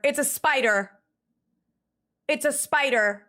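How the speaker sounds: ordinary delivery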